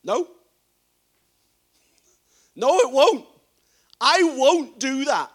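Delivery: loud, insistent no, slowing on the last words; emphatic refusal. Retold or imitated. Peter flatly refusing Jesus's warning, loud and emphatic. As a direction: push back hard, you won't hear of it. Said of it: "emphatically saying".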